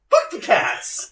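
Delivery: loud, slow, high yell; indignant outburst. Real, not acted. Co-host denied the good chair, indignant outburst. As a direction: curse it out in loud protest.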